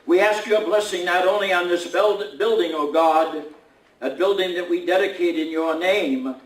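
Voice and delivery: solemn spoken invocation to God; prayer. Acted. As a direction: lift the voice in earnest prayer. Reverend moves into a prayer.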